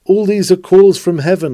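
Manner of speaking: normal talking voice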